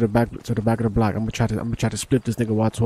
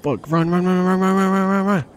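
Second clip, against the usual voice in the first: high, rapid-fire urgent repeats; urgent order; 8 semitones higher than usual. Real, not acted. Player telling the crew to run, urgent. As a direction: urge them to flee in one sustained high stream.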